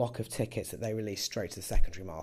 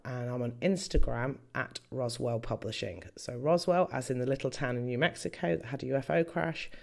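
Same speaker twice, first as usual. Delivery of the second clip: ordinary talk, nothing marked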